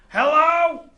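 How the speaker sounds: high, loud call from a distance; screaming for help. Retold or imitated. Old customer calls for any worker from across the store, high holler. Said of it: "they're just screaming for help".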